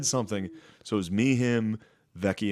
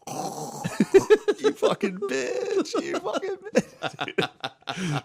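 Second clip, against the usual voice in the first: very high, drawn-out squeaky taunt; breathy gasp into a slow falsetto jeer, hammed-up; mock taunting; much slower than usual. Mock reaction to friend's fan question.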